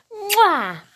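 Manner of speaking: high, exaggerated kiss noise; big kiss. A narrator's reading. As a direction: plant a noisy pretend kiss. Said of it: "gives Bing a big kiss".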